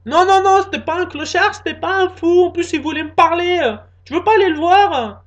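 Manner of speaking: put-on high, loud girlfriend pushing; girlfriend's voice. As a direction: egg him on in a high voice.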